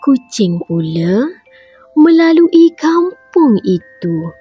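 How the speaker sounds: ordinary delivery, nothing marked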